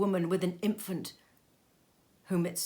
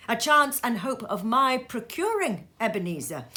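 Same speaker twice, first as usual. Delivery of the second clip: a higher, louder voice for the ghost of Marley, solemn and warning; the dead partner's grave warning, raised and intoned; Marley's ghost; 6 semitones higher, 6 dB louder than usual. Acted. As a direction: ghost voice delivering a dire warning, pitched up. Marley's ghost warns Scrooge.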